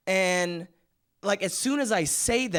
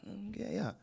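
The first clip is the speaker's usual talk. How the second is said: reluctant mumble; 2 semitones higher, 13 dB quieter than usual. Retold or imitated. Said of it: "I was like".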